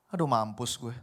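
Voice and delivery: low, sleepy mumbled complaint; groggy just-woke groan. Retold or imitated. Man waking up, groaning in a low sleepy mumble. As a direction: moan it like you just woke up.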